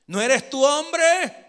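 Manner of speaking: high, loud, drawn-out challenge; shouted taunt. Acted. David provoking Abner from afar, shouted taunt. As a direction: call out a provocation.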